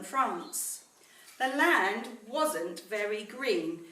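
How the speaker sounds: put-on slow, measured storytelling to children; story narration. A narrator's reading. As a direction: read it like a bedtime story to the class. Teacher reads story to class.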